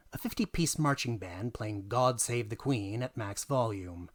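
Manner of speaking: ordinary delivery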